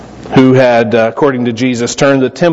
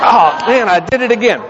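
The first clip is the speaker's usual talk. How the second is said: higher-pitched, retold embarrassed groan of words; mock dismay; 6 semitones higher than usual. Caller realising he mistook the sister for his girlfriend, mock dismay. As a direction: kick yourself lightly, pitch lifted.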